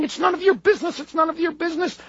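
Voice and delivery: high-pitched mock hysterical mother. Mother fending off the doctor's questions, mock hysterical. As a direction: imitate a frantic mother, pitched well up. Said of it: "the mother's hysterical, going".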